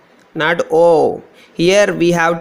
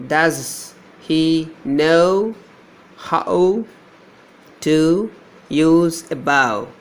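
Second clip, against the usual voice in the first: very slow, stretching each word; slow dictation; slower than usual. Shown as a demonstration. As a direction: draw out the words so the diphthongs stand out. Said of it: "Here we have a diphthong".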